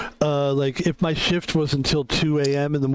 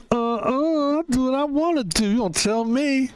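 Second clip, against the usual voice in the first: much higher, defiant mocking voice; mock sassy parker; 9 semitones higher than usual. Parker talking back to the security guard, high sassy mock voice. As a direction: talk back sassy and high, brushing him off.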